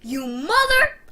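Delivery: high, loud outburst breaking off; exploding into a curse; furious cut-off curse. Retold or imitated. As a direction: yell it in rage, then stop short. Curses on finding damaged AirPods.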